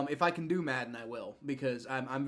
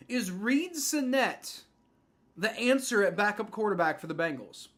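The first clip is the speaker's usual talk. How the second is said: dramatic title read; 6 semitones higher than usual. Acted. Poses it like a video title.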